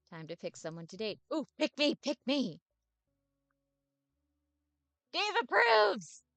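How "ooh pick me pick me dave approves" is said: loud, high, excited pleading; eager begging. Acted. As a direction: beg to be chosen like a puppy. Dave begs to be picked.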